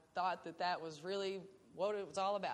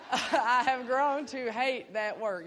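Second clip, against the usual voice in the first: louder, slower, laughing through the words; 4 semitones higher, 9 dB louder, slower than usual. Real, not acted. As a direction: laugh while admitting you hate it. Laughing about hating the job.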